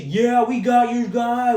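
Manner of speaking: smug slow taunt in a put-on rival voice; mocking rival fan. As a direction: lord it over them like a smug rival fan. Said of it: "all you Vikings fans were talking shit".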